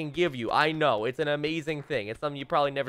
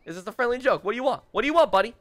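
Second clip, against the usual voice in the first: mock defensive protest; 6 semitones higher, 4 dB louder, faster than usual. Pleading with Paul, then challenging.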